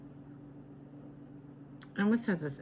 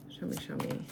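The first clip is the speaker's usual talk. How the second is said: whispering; 6 dB quieter than usual. Real, not acted. Whispered aside to the cards.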